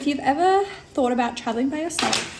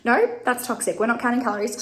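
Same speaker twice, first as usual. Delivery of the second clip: ordinary delivery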